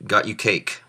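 usual speaking voice

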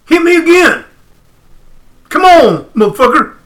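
defiant taunt. Retold or imitated. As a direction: dare the giant to hit you again. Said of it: "I'm going".

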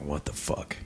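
breathless whispered disbelief; stunned whisper. Retold or imitated. Hearing on the phone that his friend died, whispering in shock. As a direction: barely get the words out, whispering.

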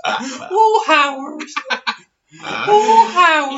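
very high, hammed-up moaning squeal; mock aroused radio guest. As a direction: wail it in mock ecstasy, loud and high. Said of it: "like Howard Stern with the prostitutes".

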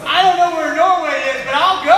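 high, full-voiced gung-ho yell; eager shout. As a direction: blurt out the acceptance at top volume. Retold eager acceptance, shouted.

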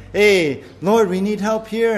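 imitating a hasty call for help; mock plea. Mock prayer voiced in sermon.

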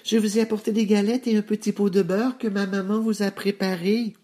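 put-on very high little-girl voice; high-pitched child answering at the door. A narrator's reading. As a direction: pipe up high, like a small child. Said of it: "répondit-elle".

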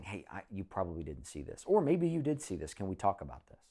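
soft, quiet inviting tone; gentle approach. Pretend. Husband bringing his wife along gently, soft. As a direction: invite her kindly to talk. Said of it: "bringing your wife along".

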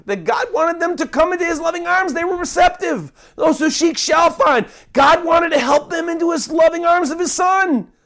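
high, rising preacher's cry; fervent high-pitched preaching, building to a shout; preaching at full force. Acted. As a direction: lift them up toward God's loving arms.

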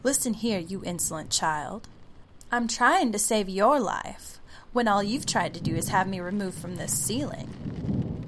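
loud, scolding haughty voice; evil queen. Acted. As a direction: scold the insolent child. Ceiling voice scolds the child.

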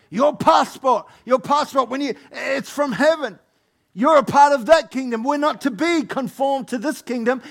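loud, high rising preacher's cry; full-force preaching. Acted. Preacher rousing the congregation, full force. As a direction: build the crowd up with fire.

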